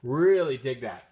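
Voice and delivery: usual speaking voice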